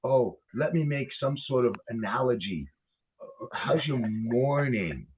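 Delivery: a lightly voiced mock-casual man, slow and soft; mock polite musing. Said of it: "turn around and go".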